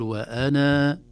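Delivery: وأنا said waanaa with final alif held; teacher shows the pause form of وأنا; alif kept at pause. Shown as a demonstration. Hold the final a of وأنا. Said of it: "أقول".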